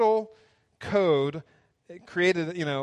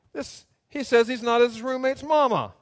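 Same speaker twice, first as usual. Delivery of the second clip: higher, louder scornful retelling of the excuse; mocking Doctor Lee; 8 semitones higher, 6 dB louder than usual. Band director mocking a student's excuse, raised and scornful. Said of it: "not happy with that answer".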